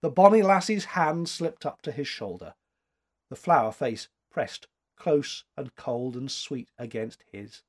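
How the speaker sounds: ordinary delivery, nothing marked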